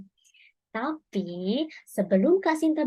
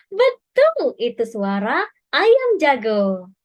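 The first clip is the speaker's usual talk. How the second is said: excited praise; 5 dB louder, slower than usual. Real, not acted. Cheering children for guessing the rooster. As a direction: cheer the right answer.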